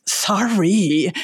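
drawn-out, put-on "sorry"; exaggerated not-meaning-it sorry; insincere apology. Shown as a demonstration. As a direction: toss off a mock apology, stretching the word. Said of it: "knows that you really mean it".

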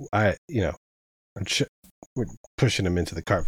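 usual speaking voice

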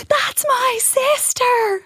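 put-on high, slow, proud child's cry; proud little brother. Little brother standing up in the audience, proudly calling out high and slow. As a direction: call it out like a beaming little boy.

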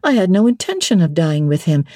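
ordinary delivery

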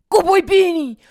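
high, loud garbled falsetto; hammed-up squealed praise; dog dad imitation. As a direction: mimic a doting dog dad's slurred whoop. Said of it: "like, a grunting sound".

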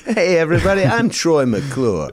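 Troy McClure voice. Retold or imitated. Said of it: "Phil Hartman character quote".

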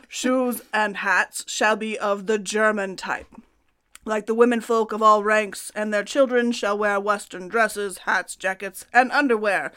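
put-on Russian accent. Host reading Peter the Great's decree to co-host, hammed-up Russian accent. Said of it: "That's my Russian accent".

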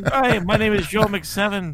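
bad, laughing Irish accent. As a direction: ham up an Irish brogue. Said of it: "That might have been the worst Irish accent ever".

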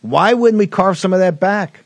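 usual speaking voice

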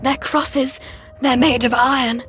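high, distressed voice; pained groan. A narrator's reading. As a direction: explain through pain. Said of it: "I groaned".

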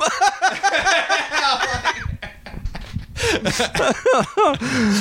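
high, helpless long laugh. Real, not acted. After the posse quote, high helpless laughter. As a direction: dissolve into laughter.

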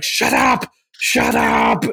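high mock yell of shut up; retold yell. Teacher losing it at a wild class, mock yell. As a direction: snap at the class like he's about to explode.

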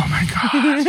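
real high-pitched amused groan; amused disbelief. Real, not acted. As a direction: react with laughing disbelief. Reacts to co-host's show joke.

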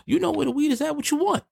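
high pitched call-out; street hustler call. Shown as a demonstration. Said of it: "this is what you supposed to do".

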